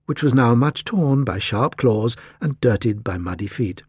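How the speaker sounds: ordinary delivery, nothing marked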